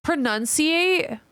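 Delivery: high, slow disbelieving question; stunned double take on the word; shocked Pronunciate?; surprised. Real, not acted. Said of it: "isn't a real word".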